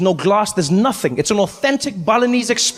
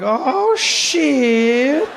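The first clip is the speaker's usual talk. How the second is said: snake realizing; 5 semitones higher than usual. Pretend. The snake also like.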